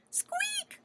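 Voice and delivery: very high whispered squeak; little mouse peep; tiny mouse squeak. Acted. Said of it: "said Mouse".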